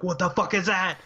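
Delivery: acting out alarm at a strange sight; startled player. Player spotting a new enemy, acted startled.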